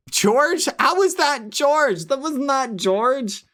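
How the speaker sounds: high, drawn-out incredulous tone; mock disbelief. Streamer answering a claim he sounded like George, mock disbelief. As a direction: protest it slowly in a high, baffled voice.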